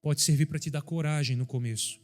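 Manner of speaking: fervent pulpit delivery, pitch lifted; preaching at full force. Acted. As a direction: preach it out high and strong to the church. Preaching to the congregation.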